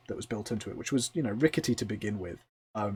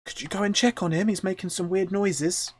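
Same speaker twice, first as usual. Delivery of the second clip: high, quick, worried request; high-pitched anxious wood elf; concerned wood elf; 9 semitones higher, 4 dB louder than usual. Acted. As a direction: plead for someone to go and check. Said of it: "a very concerned look from the wood elf".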